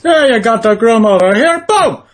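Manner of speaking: high-pitched sports commentator impression; John Madden. Comedian imitating a commentator officiating a wedding, high excited sportscaster voice. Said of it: "doing John Madden's wedding".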